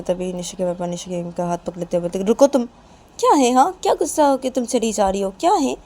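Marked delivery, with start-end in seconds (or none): 2.2-5.8 loud, high-pitched protest; urgent remonstrating. Acted. Father stops the angry mother, loudly remonstrating. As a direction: call out to stop someone leaving.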